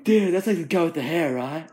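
stoner voice through a laugh. Retold or imitated. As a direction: mimic the clueless cashier, cracking up. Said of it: "And he goes".

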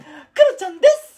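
squeaky falsetto catchphrase; very high, childlike put-on voice; Kuro-chan. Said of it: "常にクロちゃんで行く". (甲高い裏声のモノマネ)

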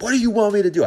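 high, loud yell at the honking driver; retold yell. Dad yelling at the taxi driver who honked, a loud yell. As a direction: shout it out the car door, fed up. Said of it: "I yelled at him".

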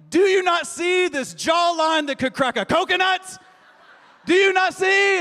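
high, loud, hammed-up disbelief; husband demanding wife admire jawline; mock-outraged boast.

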